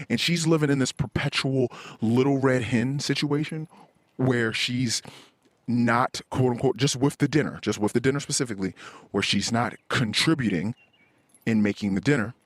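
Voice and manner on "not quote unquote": normal talking voice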